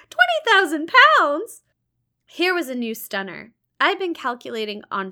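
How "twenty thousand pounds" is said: Twenty thousand pounds? much higher and louder, rising; mock astonishment. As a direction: toss Twenty thousand pounds back in hammed-up amazement. St John feigned astonishment.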